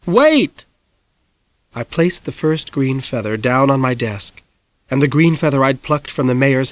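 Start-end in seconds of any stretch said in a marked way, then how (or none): 0.1-0.7 high, loud quick cry; shouting back. Acted. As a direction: yell to mom to hold on. Boy shouts back at mom.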